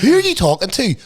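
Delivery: high, loud, scornful question; mock incredulous challenge. Host challenging an influencer with no audience, mock incredulous. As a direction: demand it of the imagined influencer.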